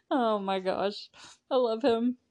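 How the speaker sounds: ordinary talk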